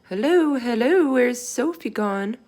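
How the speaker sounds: loud, high mock calling out; shouting. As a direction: call out like worried parents searching the house, slowing at the end.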